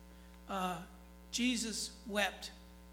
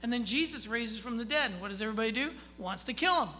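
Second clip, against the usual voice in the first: loud, high, driving sermon climb; full-force preaching; 3 semitones higher, 6 dB louder than usual. Acted. Preacher rousing the congregation, loud full-force preaching.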